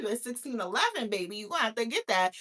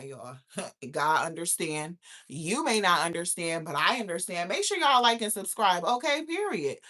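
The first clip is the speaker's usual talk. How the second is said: ordinary talk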